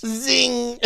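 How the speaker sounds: high punchy zing. Real, not acted. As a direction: fire off a zinger. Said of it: "I'm zinging".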